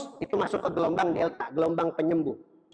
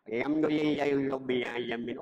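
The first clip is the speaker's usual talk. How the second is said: low, weak mumbled recitation; feeble elderly imam; 6 semitones lower, 3 dB quieter than usual. Acted. Teacher mocks a seventy-year-old imam leading prayer, feeble low chant. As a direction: recite droopily, without any energy. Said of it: "Kalau yang imam usia tujuh puluh tahun".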